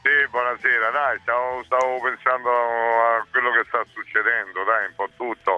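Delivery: caller on a phone line; thin phone-line voice; over a landline phone. Real, not acted. (voce al telefono)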